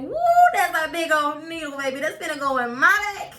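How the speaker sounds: high, loud woo with a laugh; half-joking shock at the needle; laughing alarm. Retold or imitated. Seeing the big needle, she laughs in alarm.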